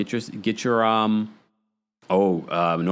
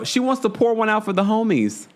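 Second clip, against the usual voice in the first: ordinary talk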